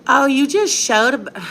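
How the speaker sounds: high, slow sneering mimic of a commenter; mocking quote, high and slow. Mother mocking a rude commenter, high and slow. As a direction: drag it out high like a snide commenter. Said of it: "Let me do it in my British accent".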